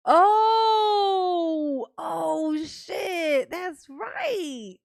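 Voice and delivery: loud, high shout of realization; sudden realization. Real, not acted. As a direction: it just hit you, yell it. Sudden realization mid-talk.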